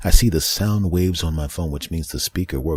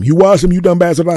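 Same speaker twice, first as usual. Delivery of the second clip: much higher, loud shocked squawk; incredulous friend; 13 semitones higher, 12 dB louder than usual. Retold or imitated. Friends shocked he washed them, high loud shriek. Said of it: "I said".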